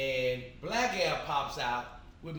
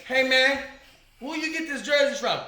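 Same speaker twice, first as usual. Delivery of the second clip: much higher, louder call-out; retold shout; 12 semitones higher, 10 dB louder than usual. Calling out the man in his jersey, loud. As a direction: yell it across the levee.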